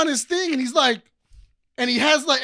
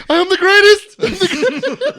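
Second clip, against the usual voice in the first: higher, much louder proud shout; mock boast; 10 semitones higher, 9 dB louder than usual. Uncle imitates nephew bragging, loud and high. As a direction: proclaim it like a kid champion. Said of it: "He was like".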